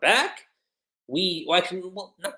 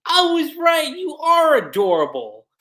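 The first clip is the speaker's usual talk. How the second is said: high, loud gushing outburst; hammed-up smitten excitement; Happy, super happy; 10 semitones higher, 5 dB louder than usual. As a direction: burst out high with delight, then ease down. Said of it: "Happy being super flustered, super happy".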